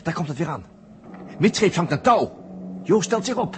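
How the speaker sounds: breathless live commentary. Acted. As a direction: cheer him on as it happens. Opgewonden verslag van de sprong. (de verteller doet luid en opgewonden verslag van Joosts sprong)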